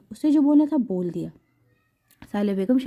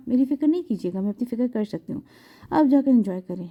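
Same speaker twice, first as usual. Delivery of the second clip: plain reading voice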